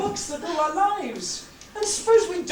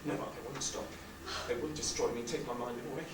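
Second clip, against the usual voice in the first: hushed fear; 13 semitones lower, 11 dB quieter, faster than usual. Acted. Husband fearful, defiant, hushed.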